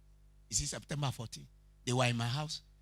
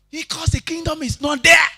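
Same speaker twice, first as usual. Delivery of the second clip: full-force shout, much higher and louder; preaching shout; 13 dB louder than usual. Real, not acted. Preacher shouting the point at the congregation. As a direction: thunder the line at the church.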